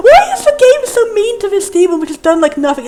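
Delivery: high, loud, pleading complaint; exasperated protest. Real, not acted. Player defending a demon the game mocks, exasperated protest. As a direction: protest at the unfairness, voice shooting up.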